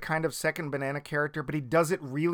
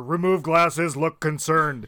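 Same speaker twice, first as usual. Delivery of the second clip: loud, booming anchorman read; Ted Baxter; 2 semitones higher, 7 dB louder, slower than usual. Retold or imitated. Anchor reading anything on the teleprompter, pompous and loud. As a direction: read the stage directions aloud as news copy. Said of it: "I go right back to Ted Baxter".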